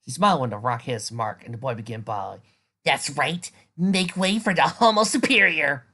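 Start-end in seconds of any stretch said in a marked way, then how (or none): 2.8-5.9 triumphant shout. Acted. Triumphant girl shouting at fleeing kids.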